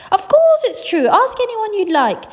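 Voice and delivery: high, loud eager insistence; excited insistence. Acted. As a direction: convince him it's really true. Grandparents insisting.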